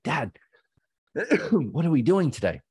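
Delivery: put-on a small boy tugging at dad in the morning; little son asking. Four-year-old son waking his dad, asking brightly in a high voice.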